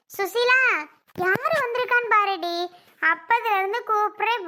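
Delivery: loud household summons with some impatience; impatient calling. Acted. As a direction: call her out, fed up with waiting. Calls Susila repeatedly, impatient.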